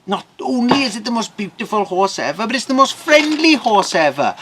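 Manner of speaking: high-pitched, gushing farmer; delighted wonder. Acted. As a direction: gush over the horse in amazement. Farmer delighted by friendly horse.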